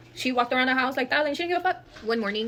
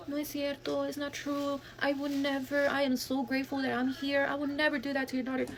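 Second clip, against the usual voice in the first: higher, softer put-on innocent voice; mock innocent cousin; 5 semitones higher, 5 dB quieter than usual. Cousin denying everything to mom, put-on innocent voice. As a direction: play the sweet liar to mom.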